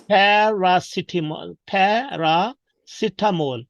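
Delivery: PARACETAMOL split, PAA-ra-SI-ta-mol; syllable-by-syllable model. Shown as a demonstration. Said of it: "Say paracetamol".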